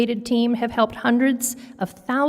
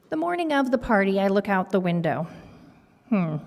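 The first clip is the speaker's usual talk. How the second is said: usual speaking voice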